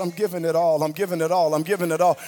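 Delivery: soft, limp repetition without conviction; half-hearted mumble. Shown as a demonstration. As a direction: say it like you don't mean it yet. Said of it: "Wrong, find three people real quick".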